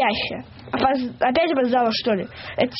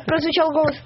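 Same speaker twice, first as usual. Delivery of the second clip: ordinary delivery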